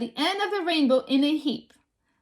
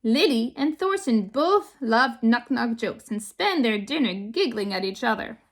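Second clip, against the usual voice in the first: ordinary delivery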